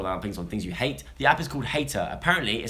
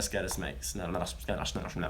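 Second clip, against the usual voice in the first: low, soft Sim gibberish; talking like a Sim; 6 semitones lower, 9 dB quieter than usual. Pretend. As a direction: mumble like a Sim character. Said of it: "I keep talking like a sim".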